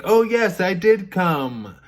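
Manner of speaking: high, loud mock objection; hammed-up denial; imitated insistent protest. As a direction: protest indignantly as the accused woman, stretching the end. Woman protesting to the king.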